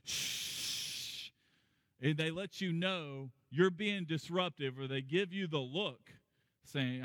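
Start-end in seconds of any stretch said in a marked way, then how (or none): 0.0-1.3 unvoiced shush. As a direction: hiss a shush at the noisy kid. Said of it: "someone leans over".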